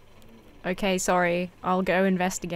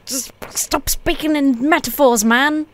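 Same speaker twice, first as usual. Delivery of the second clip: higher, fed-up protest; exasperated; 5 semitones higher, 3 dB louder, slower than usual. Real, not acted. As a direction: plead in exasperation, pitch jumping up. Fed up with the game character's metaphors.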